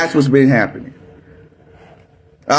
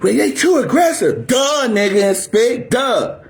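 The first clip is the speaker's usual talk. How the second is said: mock complaint; 5 semitones higher than usual. Mocking critics' complaint.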